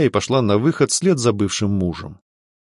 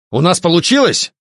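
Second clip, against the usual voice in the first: excited question, pitched high; 8 semitones higher, 4 dB louder than usual. A narrator's reading. Alfred asks whether the ritual worked, excited. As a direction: ask it with eager, lifted voice. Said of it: "взволнованно спросил Альфред".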